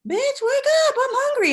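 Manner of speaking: very high squawky seagull voice; seagull's demand. Retold or imitated. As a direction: screech it like a hungry gull. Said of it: "he's like".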